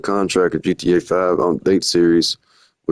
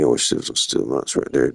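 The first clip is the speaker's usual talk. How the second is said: ordinary talk, nothing marked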